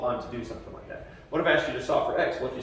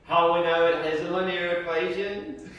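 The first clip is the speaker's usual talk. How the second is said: English accent; 4 semitones higher, 4 dB louder than usual. Shown as a demonstration. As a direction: coax the class in a put-on English accent. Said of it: "answer me if I speak English".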